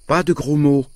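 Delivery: high-pitched scolding; stern mother. A narrator's reading. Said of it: "dit ma mère sévèrement".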